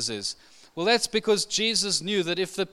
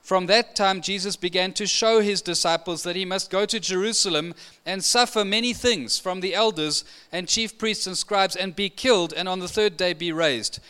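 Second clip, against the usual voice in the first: ordinary talk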